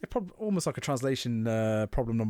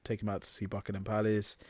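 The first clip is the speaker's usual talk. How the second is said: ordinary delivery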